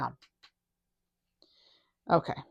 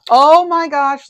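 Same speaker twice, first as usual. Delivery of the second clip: surprised gasp; 8 semitones higher, 9 dB louder, slower than usual. Real, not acted. Tarot reader reacts to card.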